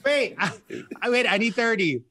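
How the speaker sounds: high, laughing wait wait; retelling her own flustered laughing stall; laughing panic. Retold or imitated. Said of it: "I was like".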